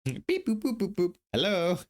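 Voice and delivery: very high chirpy beep-boop voice; robot beeps. Pretend. Playful robot noises.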